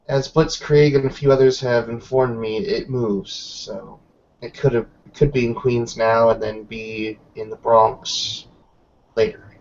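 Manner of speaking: ordinary talk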